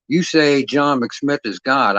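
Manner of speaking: slow, deliberate threat, each word spaced; slow menacing order. Acted. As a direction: drag it out like a gun is at their head. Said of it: "and I say".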